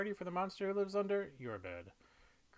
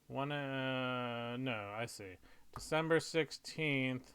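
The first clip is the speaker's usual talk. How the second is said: ordinary delivery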